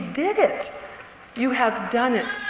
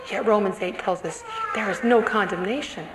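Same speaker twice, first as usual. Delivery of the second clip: ordinary talk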